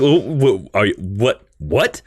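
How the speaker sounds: stammering mock bafflement; acted Matt Murdock. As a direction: stumble over the words as a dumbfounded attorney. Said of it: "Matt Murdock's like".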